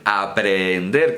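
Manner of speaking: aprender said as a-pre-en-der, the two Es separate; split double vowel. Shown as a demonstration. Teacher showing an intercalated H, aprender split as a-pre-en-der. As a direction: give each E its own syllable in a-pre-en-der for aprender. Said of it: "tiene que pronunciarse como dos sílabas".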